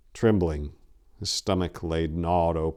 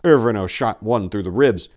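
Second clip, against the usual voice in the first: ordinary delivery, nothing marked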